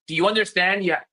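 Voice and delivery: normal talking voice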